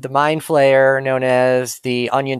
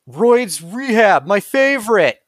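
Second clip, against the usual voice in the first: fan voice; 10 semitones higher than usual. Acted. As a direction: say it like a smug superfan. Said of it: "And the guy's like".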